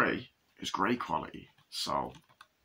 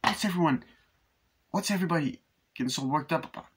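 higher-pitched quoted voice; 7 semitones higher, faster than usual. Retold or imitated. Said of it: "It's like".

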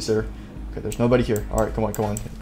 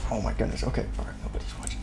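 very soft, near whisper; hushed sneaking; 5 semitones lower, 13 dB quieter, faster than usual. Pretend. Player sneaking past watchers, hushed. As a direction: keep it under your breath.

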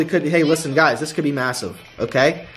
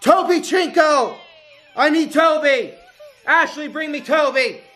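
excited calling out; 10 semitones higher than usual. Real, not acted. Calling for Toby after win.

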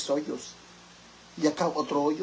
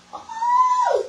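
high squeal; 6 dB louder than usual. Real, not acted.

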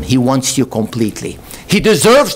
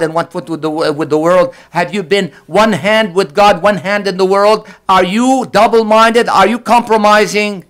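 higher, louder pressing questions; rising preaching; 8 semitones higher, 4 dB louder than usual. Acted. Preacher confronting listeners, rising and louder. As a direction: press each question harder.

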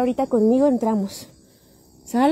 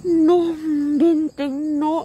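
slow, crying, stretched out; can't believe it, through tears; slow, choked with emotion; tearful disbelief; much slower than usual. Real, not acted.

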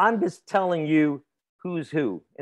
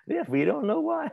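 high, put-on silly voice, much higher; mock clueless voice; 10 semitones higher than usual. Teacher echoes the student's punchline in a mock high voice. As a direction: play the clueless monkey for a laugh.

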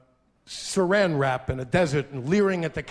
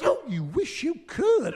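sassy comeback; 8 semitones higher, slower than usual. Retold or imitated. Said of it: "He goes".

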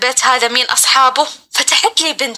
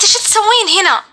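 lightly voiced young woman, higher pitch; a hint of a young girl in a higher register; young woman's voice; 6 semitones higher than usual. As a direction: imitate a girl's voice asking, pitched up. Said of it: "صوتها صوت بنت شابة".

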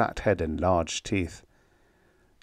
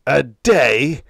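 indignant, voiced hiss; incredulous, wounded reproach; angry hiss; 6 dB louder than usual. A narrator's reading. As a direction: throw it back, offended. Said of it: "Lauren hissed".

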